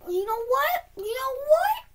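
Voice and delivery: frustrated whine. Real, not acted. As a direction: squeal it out, close to tears. Gamer cracking under frustration.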